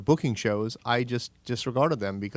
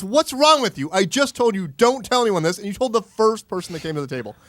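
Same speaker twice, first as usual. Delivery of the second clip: much higher and louder, berating; mock furious rant; 8 semitones higher, 3 dB louder than usual. Friend berating him for spilling a secret, high mock rant. Retold angry phone call.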